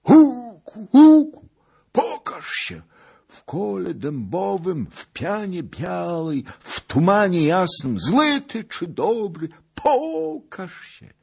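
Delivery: high, wailing loud witch chant; loud, drawn-out conjuring on a very high pitch; witch's calling incantation. A narrator's reading. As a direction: call up the spirits in a long, piercing cry. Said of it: "poczęła wolać".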